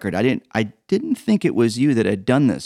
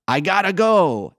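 high excited cry; hammed-up comic cry of escape; mock cry; 8 semitones higher than usual. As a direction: belt it out like a comic finale. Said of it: "climaxing the song with the cry".

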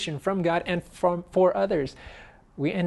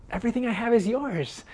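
emphatic; 8 semitones higher, faster than usual. Real, not acted. Preacher echoes father's promise.

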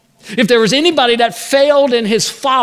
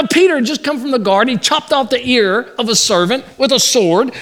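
ordinary delivery

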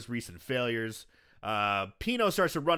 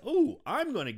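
sudden inspiration; 11 semitones higher, 3 dB louder than usual. Retold or imitated. Son gets a sudden idea.

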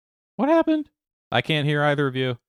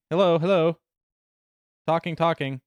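glitchy robotic call; 4 semitones higher than usual. Real, not acted. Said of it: "Yeah, you're choppy".

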